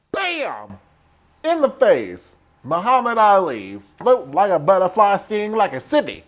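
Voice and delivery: high, punchy ringside hype; gleeful hype. Real, not acted. Recapper cheering Sidney's punch, excited hype. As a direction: cheer a knockout with relish.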